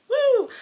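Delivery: loud, high-pitched "woo"; excited whoop. Real, not acted. Reader celebrating five books this month, excited whoop.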